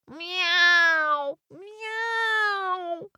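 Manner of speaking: high drawn-out meowing; cat yowl. A narrator's reading. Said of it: "The cat yowled".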